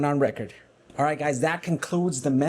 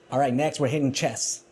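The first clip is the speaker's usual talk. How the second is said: normal talking voice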